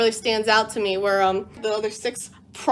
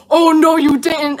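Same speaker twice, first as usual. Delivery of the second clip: higher, louder, slow sassy warning; sassy challenge; 5 semitones higher, 5 dB louder than usual. Retold or imitated. Kikuchio warns the bandit leader, sassy and loud. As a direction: drag it out like a dare. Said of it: "He's like".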